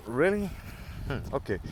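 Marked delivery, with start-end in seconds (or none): 0.1-0.5 high disbelieving really; incredulous really. Real, not acted. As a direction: sound like you can't believe it. Incredulous question.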